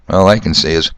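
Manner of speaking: ordinary talk, nothing marked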